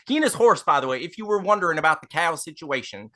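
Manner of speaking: high, apologetic, rambling driver voice; folksy carriage driver. Acted. Stand-in driver apologising to the lady passenger, high and folksy. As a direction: gush apologies like an eager-to-please coachman. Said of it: "can't see anything other than Ted Lasso".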